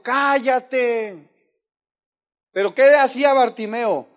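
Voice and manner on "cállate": high, loud, drawn-out rebuke; imitated shouting. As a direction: shout them down. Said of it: "le gritaban eso".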